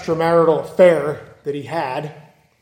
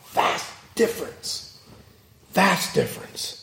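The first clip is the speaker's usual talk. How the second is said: softer, breathy repeated aside; hushed whisper; 8 dB quieter than usual. Real, not acted. Preacher stressing the contrast to the congregation, in a whisper. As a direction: let it sink in, barely voiced.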